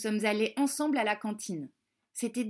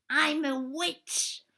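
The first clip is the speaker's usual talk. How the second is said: high, slow playful witch voice; hammed-up witch; pretend witch; 4 semitones higher, much slower than usual. A narrator's reading. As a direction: announce yourself as a spooky witch, drawn out. Children scare pupils in costume.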